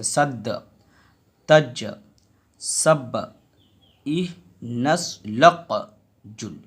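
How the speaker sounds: final consonant bounced with a small echo; qalqala echo. Shown as a demonstration. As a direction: let the last letter knock and rebound. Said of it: "double आवाज़ आया है".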